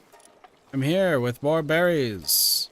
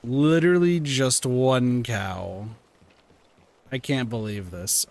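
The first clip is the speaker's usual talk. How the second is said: ordinary delivery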